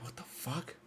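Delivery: very soft, voiceless mutter; retold shock under the breath; stunned disbelief. Rider to himself after escaping, stunned hush.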